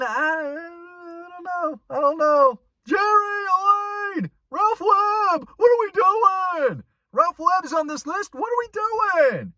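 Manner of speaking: hammed-up comic wailing disbelief at a list; strained high shout of a standup whining "what are we doing"; Seinfeld impression. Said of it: "that terrible, uh, Seinfeld little action impression".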